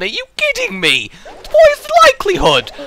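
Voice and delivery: high, loud cry of disbelief; can't believe the bad luck; exasperated disbelief. Real, not acted. Unlucky boss draw.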